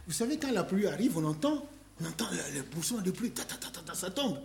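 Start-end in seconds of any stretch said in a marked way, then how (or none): 3.3-3.8 soft breathy patter of rain; rain sound. Retold or imitated. Preacher mimicking falling rain, soft patter. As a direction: tap out the rain softly.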